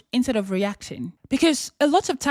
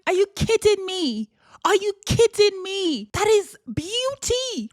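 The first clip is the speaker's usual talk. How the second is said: high, slow, awed disbelief; marvelling; 8 semitones higher than usual. Real, not acted. Speaker marvelling at a simple love you, high and slow. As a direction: savour it like a wonder.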